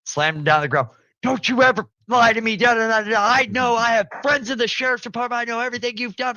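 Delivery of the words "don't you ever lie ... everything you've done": high, loud scolding rant; aunt yelling at her lying nephew, voiced high and loud; imitated furious aunt. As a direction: mimic the aunt tearing into you.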